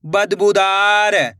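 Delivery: syllables joined into one slow word; blended word. Shown as a demonstration. Teacher joining the syllables for learners, slowly blended. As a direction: read it as one whole, stretched word. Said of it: "तो मिलके बन जाएगा".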